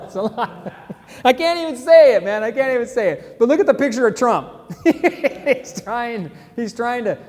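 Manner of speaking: high, cracking up mid-word; can barely get the words out for laughing. Real, not acted. Host reading a Trump meme, dissolving into laughter. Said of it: "I can't stop laughing at".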